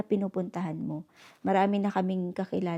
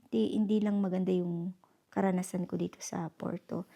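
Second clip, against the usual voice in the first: usual speaking voice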